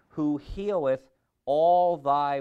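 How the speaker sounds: very slow, loud call; scripture reading. Acted. Preacher prompting the congregation, slow loud call. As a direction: stretch the verse out loudly, inviting a response. Reads verse, prompting crowd.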